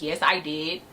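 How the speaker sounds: low, curt reply with attitude. Retold or imitated. Customer answering the cashier at the register, with attitude. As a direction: imitate a customer giving attitude. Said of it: "she had an attitude with me".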